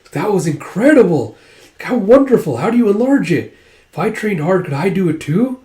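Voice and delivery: an awed warrior fanboying over enlarged legs, high and loud; excited fan gushing. Retold or imitated. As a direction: gush like an eager kid begging to learn. Admiring onlooker gushes.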